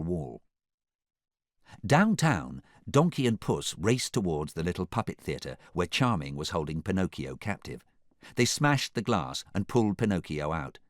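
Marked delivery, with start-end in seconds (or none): none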